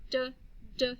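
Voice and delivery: D as a short "duh", repeated; short, separate "D" sounds, duh, duh; letter sound. Shown as a demonstration. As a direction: say the sound of "D", not its name. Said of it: "and the sound is D".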